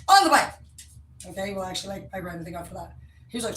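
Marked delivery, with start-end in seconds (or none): 0.1-0.5 high, loud drill-sergeant bark; Italian guy shouting. Retold or imitated. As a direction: bellow it like a pushy Italian trainer. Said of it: "He's like".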